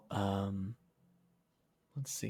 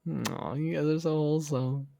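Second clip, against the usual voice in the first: touched aww; 4 semitones higher, slower than usual. Real, not acted. Touched by chat.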